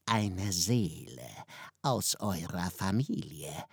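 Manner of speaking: name the price quietly and slowly; the devil. A narrator's reading. Devil demands a soul from the family, low and slow. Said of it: "antwortete der Teufel".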